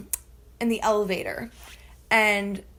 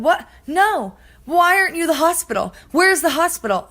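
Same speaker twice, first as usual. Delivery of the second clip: high, loud, frantic demand; exasperated outburst, retold with raised pitch; acted-out alarm; 8 semitones higher, 6 dB louder than usual. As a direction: demand directions in mock panic. Acts out alarmed reply at nail salon.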